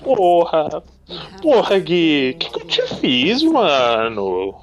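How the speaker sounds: loud exasperated swearing; frustrated cursing. Real, not acted. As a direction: curse at the screen, fed up.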